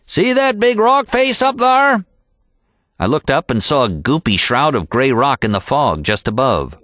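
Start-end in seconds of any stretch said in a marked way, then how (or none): 0.1-2.0 higher, twangy mountain-man call; old packer's yell. A narrator's reading. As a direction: point it out up the trail, hollering. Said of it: "Finally, Happy yelled".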